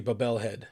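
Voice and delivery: Bobelhead read as "buh-BELL-head"; phonetic misreading. Real, not acted. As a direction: sound out Bobelhead literally, stressing "bel". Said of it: "I love the phonetic re-, readings".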